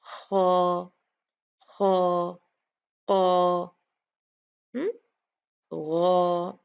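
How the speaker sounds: ख and ग voiced full and heavy from the back; heavy letter sounds. Shown as a demonstration. Teacher demonstrates heavy letters to students. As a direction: show the thick, heavy letters. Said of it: "तो फिर हमारी जुबान की position ये होगी".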